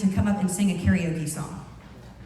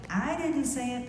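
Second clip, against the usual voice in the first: I drawn out and higher; stress on I; 4 semitones higher than usual. Shown as a demonstration. Speaker showing how meaning changes, stress on I. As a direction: lean on I, pointing blame elsewhere. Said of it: "It changes".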